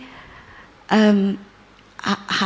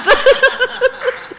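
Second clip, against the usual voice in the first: high burst of laughter; 4 dB louder than usual. Real, not acted. Laughing at steamy taxi.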